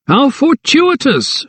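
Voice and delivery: high, slow, self-satisfied gentleman; smug magistrate. A narrator's reading. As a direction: savour the words like a pleased magistrate. Magistrate's voice, Sir Arthur.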